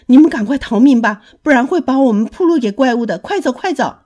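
loud, fast panicked warning; urgent shout. Acted. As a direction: yell at them to run for their lives. 老公鸡催大家逃命. (惊慌地大声催促逃命)

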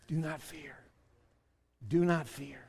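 quiet, gentle comfort; soft, tender comfort; soft reassurance. Pretend. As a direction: murmur it like a promise. God speaking gently to everyone.

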